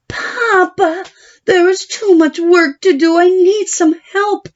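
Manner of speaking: a daughter begging papa for help; young Babylona pleading. Acted. Babylona pleads with her father.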